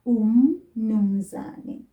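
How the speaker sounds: "Umnumzane" drawn out very slowly; slow word. Shown as a demonstration. Teacher slowly recaps the word of the day. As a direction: stretch "Umnumzane" out. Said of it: "word to learn".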